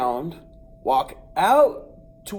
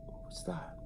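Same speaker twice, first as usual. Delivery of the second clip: much softer whispered question; 17 dB quieter than usual. Retold or imitated. The boy whispers in the sudden quiet after the rooster falls. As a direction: whisper it, dazed. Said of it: "I'm like".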